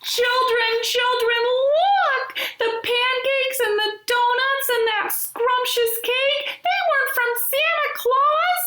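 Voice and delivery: mother hen calling her chicks excitedly. A narrator's reading. Mama Chicken calls her chicks.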